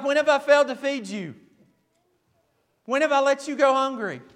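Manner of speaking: high, loud, incredulous reproach; indignant parent. Acted. Preacher as parent questioning self-feeding baby, indignant. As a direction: demand it loudly as an affronted parent.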